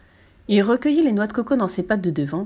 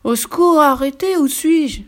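higher, louder dazed cry; dazed panic; 7 semitones higher, 6 dB louder, slower than usual. A narrator's reading. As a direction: beg for mercy, reeling. Dazed crocodile begs for help. (supplication affolée et étourdie)

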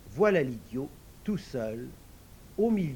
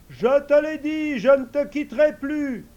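much higher, louder eager servant voice; invisible servant; 12 semitones higher, 11 dB louder than usual. Acted. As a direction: answer the master high and ready. Servant insists.

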